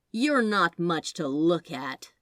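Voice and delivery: a snide angel, unimpressed and dismissive. Acted. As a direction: sneer it down at a lesser being. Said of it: "said the angel snidely".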